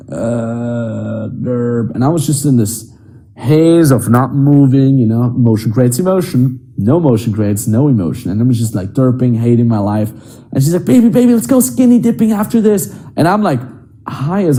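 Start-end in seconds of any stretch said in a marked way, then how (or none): 0.1-1.9 stoned derp. Retold or imitated. Stoned in the sauna. 10.8-12.9 put-on eager girlfriend. Girlfriend inviting him to swim.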